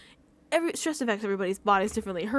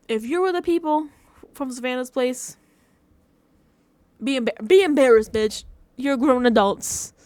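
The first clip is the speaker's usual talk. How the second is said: mad rant; 6 dB louder than usual. Real, not acted. Scolding the people from Savannah's place in a loud angry rant. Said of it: "I had to be mad".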